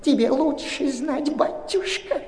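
put-on high, slow, breathy whine of an old crone; artificial whining old woman. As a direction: whine it out falsely like a village granny. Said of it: "Заныла ... искусственным голосом".

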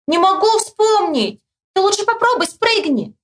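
high, stretched-out holler; high calling voice; shouting. A narrator's reading. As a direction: yell advice to someone riding past. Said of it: "Мишка снова кричит".

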